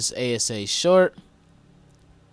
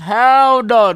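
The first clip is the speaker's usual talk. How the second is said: loud laughing refusal; 9 semitones higher, 11 dB louder than usual. Real, not acted.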